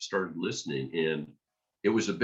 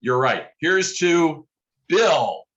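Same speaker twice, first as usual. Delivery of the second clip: a loud, higher mock toast; booming toast; 10 semitones higher, 7 dB louder, much slower than usual. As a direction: raise a glass and call it out loud. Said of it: "Bob Breyer said".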